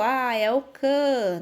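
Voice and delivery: "can" said slowly and firmly as the stressed syllable; slow stress demo. Shown as a demonstration. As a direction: linger on "can" to show the stress. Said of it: "sílaba tônica". (sílaba "can" dita devagar e marcada)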